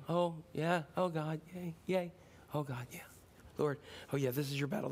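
low, soft and limp; lazy lukewarm worshipper, mocked; mock half-hearted praise. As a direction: mumble it with no faith. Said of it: "they didn't go".

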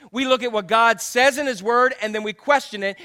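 ordinary talk, nothing marked

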